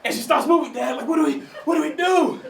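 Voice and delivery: mock-panicked virgin son pleading; panicked kid. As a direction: blurt it out in a panic to dad. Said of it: "I'm like".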